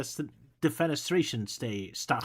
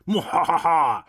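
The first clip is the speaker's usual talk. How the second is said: evil laugh; 6 dB louder, slower than usual. Acted. Bad guy's evil laugh.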